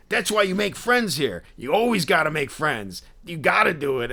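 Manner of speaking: high, emphatic dude voice; intense Philly dude. Retold or imitated. Collector advising two fans in line, emphatic high dude. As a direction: preach it as the in-your-face guy. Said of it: "the impression that I was doing of him".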